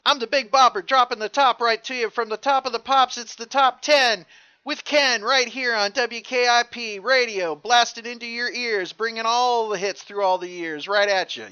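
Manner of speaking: loud, high hyped radio DJ; sixties radio DJ. Retold or imitated. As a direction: hype it like an AM jockey. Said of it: "they would talk so fast".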